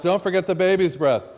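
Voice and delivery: half-mock customer yell; retold yell. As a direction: call it out like a customer shouting across the shop. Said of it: "And now the guy yells".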